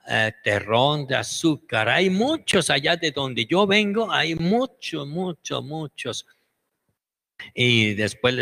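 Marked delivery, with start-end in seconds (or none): 1.9-6.3 high ant voice fading. Retold or imitated. The ant-scientist tells the ants of sugar, high voice fading. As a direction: let the repeated word fade away.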